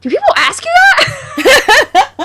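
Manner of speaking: high, slow, loud laughing question; laughing disbelief. Retold or imitated. Retelling Ruffalo's reply to interviewer, laughing high. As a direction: ask it cracking up in disbelief. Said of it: "he was like".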